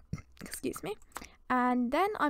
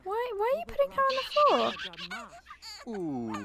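puzzled high question; 9 semitones higher, 4 dB quieter, slower than usual. Real, not acted. Questioning Sim's odd action.

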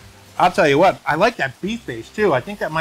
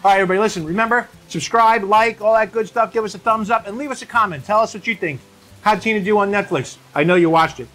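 upbeat sign-off; 5 semitones higher than usual. Acted. Signing off the video to viewers.

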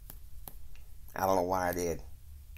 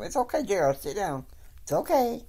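reassuring; 8 semitones higher than usual. Retold or imitated. Betsy calming the sobbing Jared.

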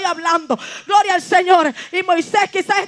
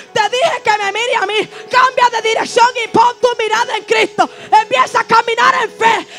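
fervent rising exhortation, louder and higher, slowing to drive it home; preaching at full force; 4 semitones higher, 5 dB louder than usual. Real, not acted. Preacher urging a fearful believer to look to God, shouting. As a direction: push the congregation hard, like a revival preacher.

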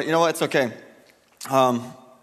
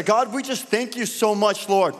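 fervent prayer; 3 semitones higher than usual. Acted. Pastor leading the standing church in prayer, fervent and earnest. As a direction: pray aloud for the city with rising fervor.

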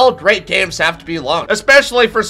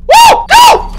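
high, loud excited yell; excited whoop; 10 dB louder than usual. Real, not acted. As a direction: cheer yourself on, thrilled. Escaping the chaser.

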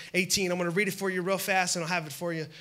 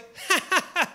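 high mocking cackle, higher pitched; Sarah's laugh; 9 semitones higher than usual. Preacher acting out Sarah laughing at the promise, high mocking cackle. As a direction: laugh it off like an old woman hearing nonsense. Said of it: "She laughs".

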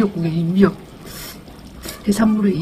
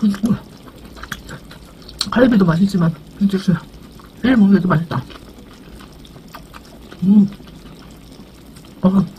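talking around hot food; chewing while talking; 2 semitones higher than usual. Real, not acted. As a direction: mouth full of food. Seen 1: Cheeks bulge and jaw works; hand fans mouth from hot food.

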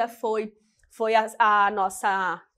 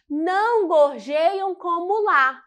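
slow, higher-pitched, deliberate line reading; measured verse reading; 6 semitones higher, 3 dB louder, slower than usual. Shown as a demonstration. Teacher reading a verse to count syllables, slow and measured. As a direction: lay the verse out slowly for counting. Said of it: "Sílabas gramaticais".

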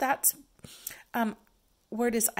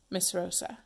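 normal talking voice

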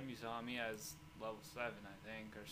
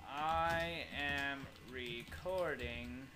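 usual speaking voice

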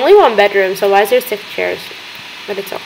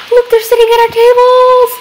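high, loud squeal of delight; thrilled, almost shrieking; overjoyed at the customers; excited; 13 semitones higher, 4 dB louder than usual. Real, not acted. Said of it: "I'm so excited for that".

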